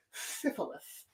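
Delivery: breathy whisper of the word. Shown as a demonstration. Streamer whispering the word to chat, hushed. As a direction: whisper it like a dirty secret. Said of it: "let's not just roll off the tongue".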